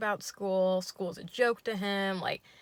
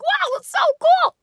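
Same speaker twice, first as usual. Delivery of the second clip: far higher, louder and fast goofy squeal; yay voice; 16 dB louder than usual. Retold or imitated. Date squeals at the tiramisu in a goofy voice. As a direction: squeal it like an overexcited man. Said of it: "yay voice".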